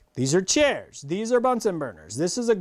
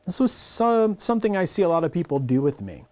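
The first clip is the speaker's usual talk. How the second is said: ordinary delivery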